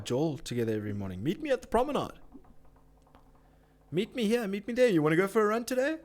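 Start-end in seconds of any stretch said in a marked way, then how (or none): 1.2-6.0 mocking mates.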